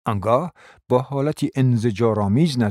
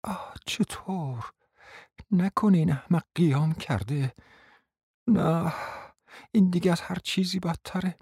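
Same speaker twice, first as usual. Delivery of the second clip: soft, hushed inner voice; alarmed thought spoken softly to herself; 7 semitones higher, 5 dB quieter than usual. A narrator's reading. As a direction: mutter it under your breath. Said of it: "با خود گفت".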